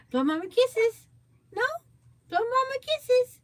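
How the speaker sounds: squeaky high sing-song coaxing. Real, not acted. Coaxing toddler to blow kisses.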